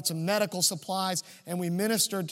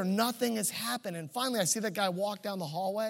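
ordinary talk

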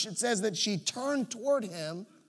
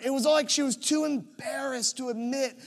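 normal talking voice

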